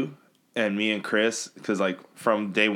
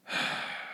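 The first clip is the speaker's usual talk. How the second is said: resigned sigh; 10 dB quieter than usual. Retold or imitated.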